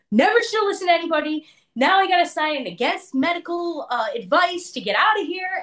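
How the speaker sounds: high, quick exasperated rant; cursing. Retold or imitated. Woman cursing her brothers from the hospital, exasperated. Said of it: "I'm sitting there cursing my brothers".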